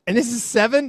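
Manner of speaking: usual speaking voice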